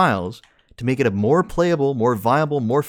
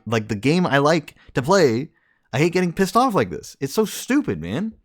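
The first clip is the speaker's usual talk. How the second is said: raised, heated complaining; vent, getting more worked up; frustrated rant; 7 semitones higher, 3 dB louder than usual. Real, not acted. Streamer venting about the game mode to teammate, heated and fed up. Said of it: "All right, rant over".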